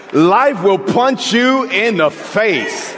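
slow, high, pounding preacher voice; full-force preaching. Acted. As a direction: drive each phrase home from the pulpit. Preaching climax.

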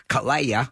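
Kalea said as three syllables, ka-le-a; three-syllable Claire. Retold or imitated. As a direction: stretch Kalea into three beats. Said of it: "Claire and make it a".

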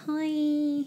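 little mouse voice. Retold or imitated. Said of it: "weird little mouse voice".

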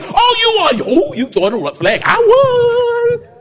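high sing-song gloat; high taunting victory crow; mock gloating winner. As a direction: crow over the win in a high voice. Preacher mocks gloating winner.

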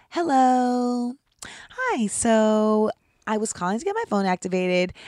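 prim, proper phone voice; polite customer service voice. Shown as a demonstration. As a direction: put on a sweet, prairie bonnet politeness. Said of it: "I have to change my voice when I call customer service".

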